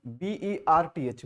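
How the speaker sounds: B E R T H spelled out slowly, letter by letter. Shown as a demonstration. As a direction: dictate each letter of B E R T H with a pause. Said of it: "B के बाद आई नहीं है बल्कि ई है". (अक्षर-अक्षर धीरे बोलना)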